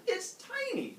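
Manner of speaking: very high incredulous squeak; mock squabble, voice way up. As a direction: object in comic disbelief. Acted argument over the bull.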